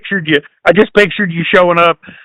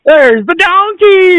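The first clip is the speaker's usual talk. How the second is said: mock quote; 11 semitones higher, much slower than usual. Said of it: "And I'd be like".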